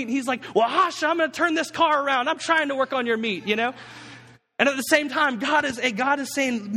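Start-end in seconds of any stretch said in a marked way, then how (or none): 0.5-3.5 higher-pitched mock dad scolding; exasperated parent ranting. Preacher playing Moses as a parent in the car, exasperated. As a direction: snap at whining kids in the back seat. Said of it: "he starts ranting to God".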